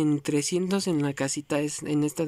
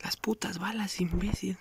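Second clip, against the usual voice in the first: a sharp whispered curse; whispered insult; 2 semitones higher, 6 dB quieter than usual. Real, not acted. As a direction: hiss the insult under your breath. Cursing at running out of ammo.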